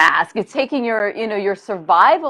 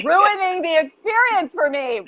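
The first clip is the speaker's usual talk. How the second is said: exasperated retort; 7 semitones higher than usual. Acted. Answering the inner critical voice.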